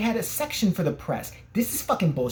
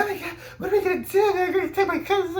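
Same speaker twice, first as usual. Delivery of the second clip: high-pitched, hammed-up freak-out; squealed comic alarm, voice far up; mock panic. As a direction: imitate a friend panicking over confiscated snacks. Acting out Steve panicking over candy.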